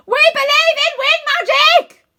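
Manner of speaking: very high, loud enthusiastic yell; big excited group yell; yelling together. A narrator's reading. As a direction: shout it with all your heart. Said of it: "we both yell".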